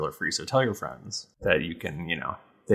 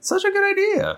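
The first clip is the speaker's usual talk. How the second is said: high excited voice; 4 dB louder than usual. Retold or imitated. Continues high mock enthusiasm.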